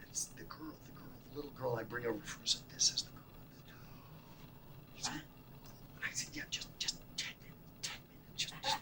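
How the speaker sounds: hushed, urgent whisper to a policeman; retold whisper. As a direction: whisper your plea so no one else hears.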